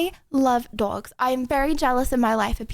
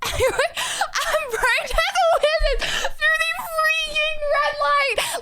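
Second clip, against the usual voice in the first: high, slow, laughing half-shout; can't believe it, laughing; laughing in disbelief; 13 semitones higher, much slower than usual. Real, not acted. Disbelief at the driver.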